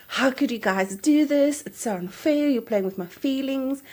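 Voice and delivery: high-pitched mock protest; retold aggrieved outburst; indignant complaint. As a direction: imitate an upset husband on a call. Retells husband's upset call to agency.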